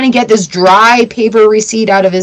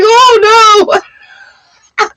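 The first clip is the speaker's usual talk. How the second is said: high, loud mock panic of a spooked dog; a dog crying oh no in fright; scared dog; 12 semitones higher, 4 dB louder than usual. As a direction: squeal it like a frightened puppy. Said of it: "He's like".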